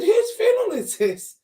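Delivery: demanding. Retold or imitated. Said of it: "I said".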